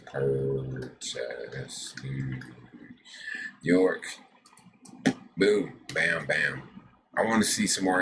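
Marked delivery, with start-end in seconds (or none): 0.1-4.0 slow drawn-out. Real, not acted. Vlogger drawing out a town name, slow. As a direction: stretch each syllable out slowly.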